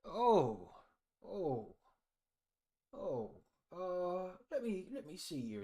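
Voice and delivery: put-on high, creaky old man asking the boys; a doddery old villager squinting at strangers; lightly voiced old man, high and quavery.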